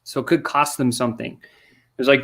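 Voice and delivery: usual speaking voice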